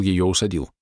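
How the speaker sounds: usual speaking voice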